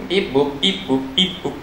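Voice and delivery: soft robotic beeps; beep boop noises. Acted. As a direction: mimic a machine beeping. Imitating machine sounds.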